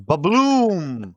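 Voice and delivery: slow, stretched-out "ba-bloom"; imitated explosion. Retelling the graboid swallowing the bomb, imitated explosion. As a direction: draw out the boom like a cartoon blast.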